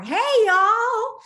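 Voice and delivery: high, loud sing-song "hey y'all"; imitating a cheery greeting. Retelling the friend's surprise visit, cheery high greeting. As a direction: mimic the friend popping up by the car.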